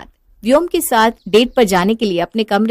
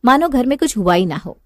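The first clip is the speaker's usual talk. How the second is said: usual speaking voice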